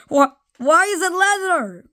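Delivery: high, disbelieving whine; exasperated disbelief. Acted. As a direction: throw up your hands in disbelief. Exaggerated baffled repeat.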